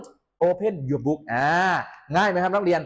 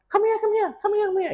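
high-pitched, repeated calling; rapid insistent beckoning, hammed-up; mock impolite summons; much slower than usual. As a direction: pester the teacher in a put-on high voice. Acts student impolitely calling the teacher over.

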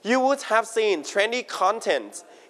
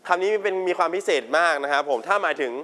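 ordinary delivery

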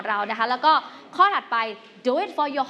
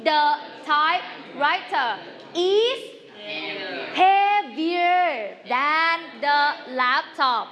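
drawn-out choral lead; 2 semitones higher, much slower than usual. Shown as a demonstration. Teacher asks students to answer together, slow and drawn out. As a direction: draw out each word for a group to join.

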